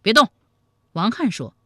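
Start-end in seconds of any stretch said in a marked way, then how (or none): 0.0-0.3 barked order to freeze; brief, forceful challenge; sharp command. A narrator's reading. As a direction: halt him with one short shout. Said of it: "喝道".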